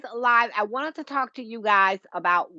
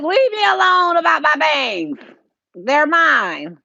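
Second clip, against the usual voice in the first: mock sassy retort; 5 semitones higher, 7 dB louder than usual. Sassy retort about her bangs.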